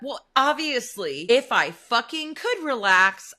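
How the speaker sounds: slow, loud exasperated retort; mock exasperation. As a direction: snap back slowly at the advice. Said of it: "I'm like".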